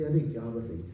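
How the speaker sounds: low, mildly dejected reply; a touch of weary reluctance to explain; downcast reluctance. Retold or imitated. Troubled Narad is asked what is wrong, mildly downcast. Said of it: "Narad जी के मुख में कुछ उदासी था".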